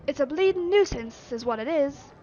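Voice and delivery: gruff loud Yorkshire grumble; Yorkshire accent. Acted. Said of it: "grumbled in a thick Yorkshire accent".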